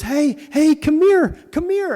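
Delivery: put-on missionary calling a kid across the park. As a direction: call the kid over excitedly.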